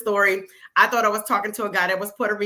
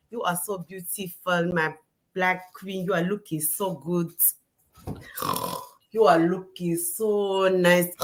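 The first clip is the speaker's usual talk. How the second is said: soft, slow Nigerian suitor voice, softer; Nigerian accent; 6 semitones lower, 3 dB quieter, slower than usual. Retold or imitated. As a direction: sweet-talk in a Nigerian accent. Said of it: "Why is her Nigerian accent on point".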